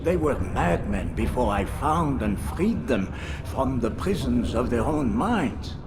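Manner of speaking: usual speaking voice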